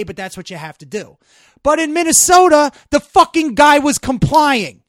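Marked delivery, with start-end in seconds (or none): 1.6-4.8 real anger, much higher and louder; erupting in outrage at an injustice; angry outburst. Real, not acted. Ranting to a co-host about the Minnesota shooting, furious and loud.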